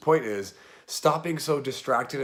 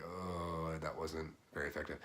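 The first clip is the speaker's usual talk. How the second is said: quiet self-talk; 5 semitones lower, 12 dB quieter than usual. Retold or imitated. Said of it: "I'm like".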